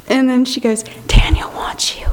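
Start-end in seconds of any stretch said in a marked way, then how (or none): 1.1-2.1 imitating an urgent church whisper; whispered message. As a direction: whisper it to the person beside you. Retold message passed during prayer.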